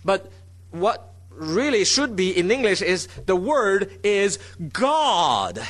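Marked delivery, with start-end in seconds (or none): 3.2-5.6 "God" drawn out long at the end; slowly, landing hard on a stretched "God"; stress on God. Shown as a demonstration. Preacher showing how English should read, heavy stress on "God". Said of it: "The emphasis is on God".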